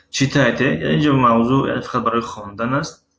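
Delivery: ordinary talk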